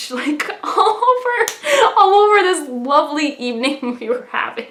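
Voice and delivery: high, laughing through the words; laughing embarrassed. Real, not acted. Telling viewers about ruining the lovely evening, laughing. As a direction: giggle it out, embarrassed.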